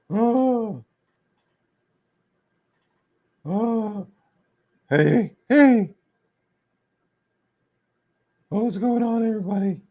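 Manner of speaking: high falsetto mocking impression; silly squeaky-high greeting; Nicholas. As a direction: hoot it in a caricature high voice. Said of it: "he sounds like that".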